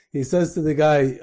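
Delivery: usual speaking voice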